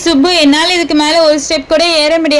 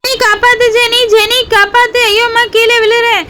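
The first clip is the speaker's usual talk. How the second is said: panicked cries; 3 semitones higher than usual. Acted. Girl falling from the hill begs friends to save her, panicked. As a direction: scream for rescue as you fall. Girl falls crying for help.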